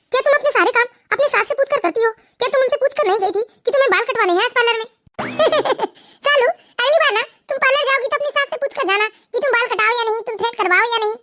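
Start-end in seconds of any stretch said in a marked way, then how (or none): 5.2-5.9 high-pitched teasing giggle; mocking laugh. Friends tease Salu about her mother-in-law, mocking laughter.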